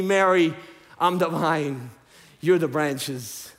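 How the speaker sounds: usual speaking voice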